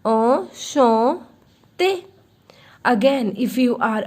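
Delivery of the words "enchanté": Enchanté drawn out slowly, nasal on-shon-TAY. Shown as a demonstration. Teacher slowing Enchanté down for learners, very slow. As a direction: stretch each syllable to show the nasals. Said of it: "both give the sound of on".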